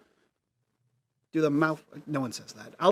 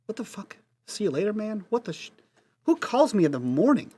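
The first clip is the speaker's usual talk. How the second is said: incredulous mutter; 6 semitones higher than usual. Acted. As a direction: mutter it in disbelief. Reacts after the caller hangs up.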